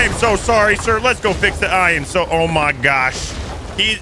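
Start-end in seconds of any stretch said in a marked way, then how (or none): none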